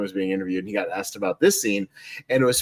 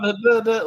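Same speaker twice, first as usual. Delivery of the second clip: high mock babble; nonsense syllables; 11 semitones higher, 3 dB louder than usual. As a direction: sing out nonsense syllables, pitched up. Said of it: "he's just saying nonsense words".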